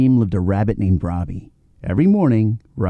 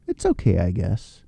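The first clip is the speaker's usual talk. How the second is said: unimpressed; 5 semitones lower, 4 dB quieter than usual. Acted. Robbie unimpressed by dam.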